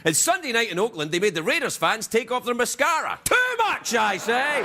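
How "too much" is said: very high, shrill exclamation; high squeal. Acted. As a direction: squeal like an upset fan. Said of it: "mock Raiders fan".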